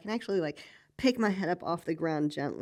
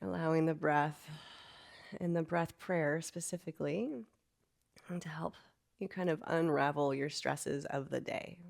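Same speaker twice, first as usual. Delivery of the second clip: guided meditation; 6 dB quieter than usual. Acted. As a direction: ease them into stillness quietly. Leads a soft meditation.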